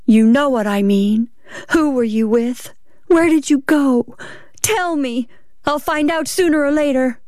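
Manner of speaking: rising, high-pitched demands; relentless interrogation. Acted. Wife pressing her husband for the truth, relentless.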